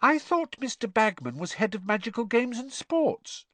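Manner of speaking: put-on high, puzzled girl's voice; surprised. Ginny surprised about Ludo Bagman, high and puzzled. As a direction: wonder aloud in disbelief. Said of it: "looking surprised".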